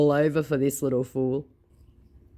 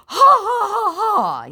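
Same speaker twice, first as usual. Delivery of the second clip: high, loud taunting ha-ha; a smug fake laugh mocking the victim; mocking scammer laugh; 7 dB louder, slower than usual. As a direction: cackle like you've just scored a point.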